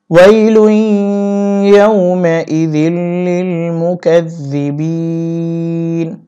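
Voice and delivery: slow melodic tilawat, each vowel drawn out; Quran recitation. Shown as a demonstration. Teacher reciting the verse to students in slow tilawat. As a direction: chant it like a reciter, slow and sung. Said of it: "teacher recites the verse".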